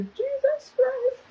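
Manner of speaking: high, slow, laughing cry of disbelief; stunned laughing exclamation; shocked laughing cry. Real, not acted.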